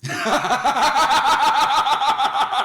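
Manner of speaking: big high-pitched burst of laughter; long, loud cackling laugh. Real, not acted. Reaction to failed Batman voice request.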